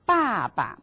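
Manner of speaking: 爸爸 with both syllables falling; falling tones. Shown as a demonstration. Teacher models 爸爸 for students, falling tones. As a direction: say 爸爸 as two clear falling-tone syllables. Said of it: "the sound's going down".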